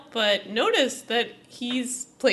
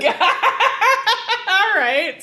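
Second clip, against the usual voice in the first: laughing hard, higher and louder; 9 semitones higher, 6 dB louder, much slower than usual. Real, not acted. Cohost cracking up at the coin joke, laughing. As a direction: break up laughing.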